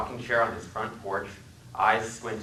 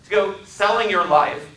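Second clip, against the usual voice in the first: mocking question; 11 semitones higher, 6 dB louder than usual. Narrator mocks the passenger.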